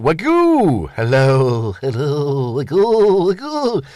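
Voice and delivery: lightly voiced Peter Sellers, rising high on wagoo; a hint of Sellers' comic character, bouncing up on each wagoo; Peter Sellers impression. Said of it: "My best Peter Sellers for you".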